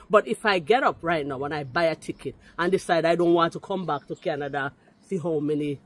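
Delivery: ordinary talk, nothing marked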